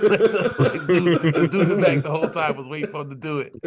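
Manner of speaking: loud, high, laughing storytelling; laughing while talking. Real, not acted. Retelling a concert story to co-hosts, laughing. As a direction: tell it through real laughter.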